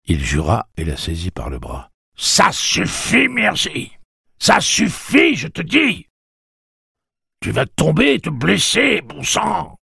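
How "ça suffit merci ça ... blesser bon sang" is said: high, loud, worked-up scolding; angry, high-pitched warning; exasperated shouting. A narrator's reading. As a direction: shout at them to stop, out of patience. Man curses, grabs the struggling woman.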